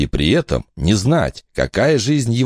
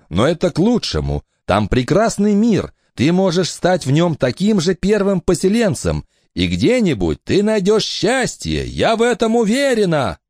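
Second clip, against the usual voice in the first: higher, lively woman's voice; mother's voice; 13 semitones higher than usual. Acted. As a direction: speak up bright as the mother. Mother reassures.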